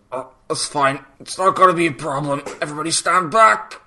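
high, hurried character voice; put-on voice building in pitch and urgency; Rigel. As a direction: imitate a panicky creature insisting all is fine. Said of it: "Rigel just chimes up and goes".

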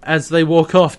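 normal talking voice

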